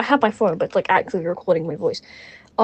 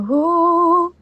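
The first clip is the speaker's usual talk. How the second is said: long, raised "ooh"; drawn-out ooh; 9 semitones higher, 6 dB louder than usual. Shown as a demonstration. Reader guessing what kind of O this is, long high ooh. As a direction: hold one high, sustained ooh. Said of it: "we're just gonna go".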